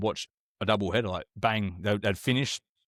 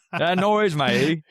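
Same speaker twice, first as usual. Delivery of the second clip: much higher and louder, a hammed-up blustering Aussie politician threatening someone; mock Bob Katter; 7 semitones higher, 5 dB louder, much slower than usual. Comedian's character mocks Bob Katter, threatening someone, loud high hammed-up voice. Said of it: "he was ripping off that Bob Catter".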